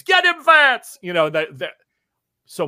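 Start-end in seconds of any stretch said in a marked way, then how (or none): 0.0-1.0 high loud crowd cheer; scream. Acted. Someone in the crowd cheering Vance on, shouted. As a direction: egg the fighter on with a yell. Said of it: "somebody screams".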